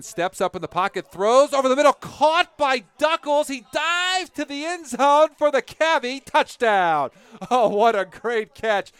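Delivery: high, loud play-by-play erupting on the catch; exultant touchdown call. Real, not acted. Announcer calls a diving touchdown catch to listeners, exultant shout. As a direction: shout the big play, stretching the key words.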